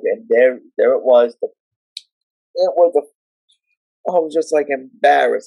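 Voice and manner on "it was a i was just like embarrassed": ordinary delivery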